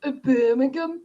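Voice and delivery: sing-song mock Birmingham voice; attempted Birmingham accent. Shown as a demonstration. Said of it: "can't even do the Birmingham accent".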